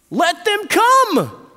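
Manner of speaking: high, loud, drawn-out order; annoyed, forceful shout; indignant command. Acted. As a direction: shout it out indignantly, stretching the words. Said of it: "it says that he was indignant".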